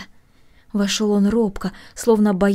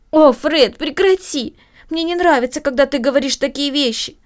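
upset protest; 6 semitones higher, 4 dB louder than usual. Acted. Sister protests to brother.

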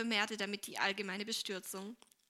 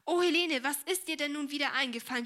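higher, louder exclamation, easing off; dismayed cry; 5 semitones higher, 5 dB louder than usual. A narrator's reading. Mother spots Minnie on the table, dismayed cry. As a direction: cry out in exasperated surprise. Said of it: "blickte sich um und rief".

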